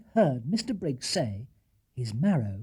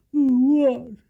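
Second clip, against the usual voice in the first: yawning; 11 semitones higher, 9 dB louder than usual. Acted. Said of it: "stifling a yawn".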